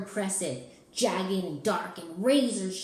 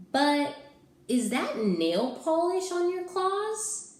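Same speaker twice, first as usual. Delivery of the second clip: put-on kid voice; 10 semitones higher, 6 dB louder than usual. Kid asks Ralph.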